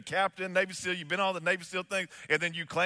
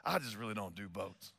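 much lower and softer, a sheepish mumble; mock a tough man admitting fear, meekly; hushed, hesitant, imitating timid Bill; nervous confession; 7 semitones lower, 5 dB quieter than usual. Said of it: "starts getting nervous".

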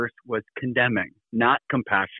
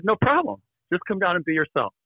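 higher-pitched, lightly voiced woman caller; breezy retold caller; 6 semitones higher than usual. Producer talking a reluctant pastor into acting, lightly voiced. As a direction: lift the pitch to quote her reassurance. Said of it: "And she said".

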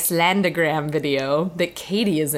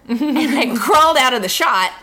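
normal talking voice

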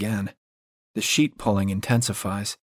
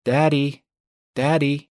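higher, lightly voiced child calling for daddy; a hint of a small child's call, pitched up; little girl calling; 5 semitones higher, slower than usual. A narrator's reading. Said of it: "coming from the foot of the bed".

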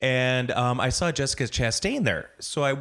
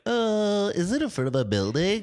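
high worried voice; 7 semitones higher than usual. Retold or imitated. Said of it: "I'm like".

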